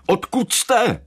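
high, urgent demand; suspicious sentry's high call; barked question. A narrator's reading. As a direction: challenge the stranger sharply. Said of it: "křikl na mě".

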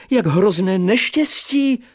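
put-on high, slow wail of an old man; lamenting teacher. Old teacher laments the death news, high and slow. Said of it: "bědoval".

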